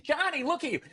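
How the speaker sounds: tut-tut at a messy grown brother; scolding big sister. Retold or imitated. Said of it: "like, dressing".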